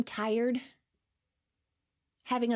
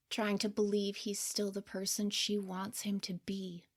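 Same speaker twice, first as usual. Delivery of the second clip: hushed, gentle third-person commentary, half whispered; soft narrator aside; 3 dB quieter than usual. A narrator's reading. Narrator looking back on her younger self, soft and hushed. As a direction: speak softly over the scene like a caring observer.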